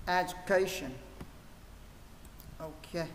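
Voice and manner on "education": "Education" said clearly as one word, stress on CA; model pronunciation. Shown as a demonstration. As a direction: model "Education" for learners to repeat. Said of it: "đọc lại từ này".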